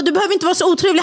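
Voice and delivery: high, quick mock reproach; förebrående citat. Återberättat gräl med larmoperatören. (förebrående, ljust och snabbt härmat)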